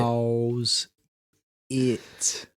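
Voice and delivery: "how's" and "it" drawn out separately; slow robot sounding out "how's", then "it"; robotic word-by-word. Shown as a demonstration. Learner practising the Welsh example, robotically stretched. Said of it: "You sounded like Johnny Five Alive".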